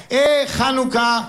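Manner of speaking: put-on slow, high, wide-eyed child's voice; a small girl delighted by the candles; little girl. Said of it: "אמרה". (קול ילדה קטנה, איטי ומתפעל)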